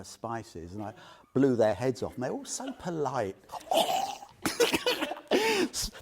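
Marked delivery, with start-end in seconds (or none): 3.4-5.3 breathy laugh. Real, not acted. Laughing at his cooking blunder.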